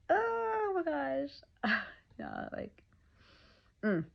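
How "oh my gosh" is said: teary overwhelm. Real, not acted. Expectant mother near tears over the coming birth, cracking voice. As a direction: voice cracking high into a near-cry. Overwhelmed thinking about labor pain.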